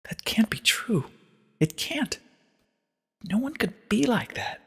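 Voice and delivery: high, soft, slow whisper of disbelief; horrified denial. A narrator's reading. Twilight denies Celestia's story in a high hushed voice. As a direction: refuse to believe it, hushed. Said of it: "Twilight shook her head".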